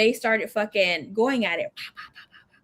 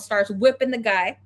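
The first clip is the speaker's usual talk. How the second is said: ordinary delivery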